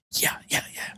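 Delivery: quick hushed agreement; whispered eager yes. Retold or imitated. Daughter whispering eager agreement to her dad. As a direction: nod along in a whisper.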